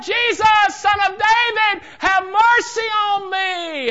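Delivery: very high, full-force shout. Acted. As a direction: cry out even harder, stretching the final plea. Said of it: "He cried out so much the more".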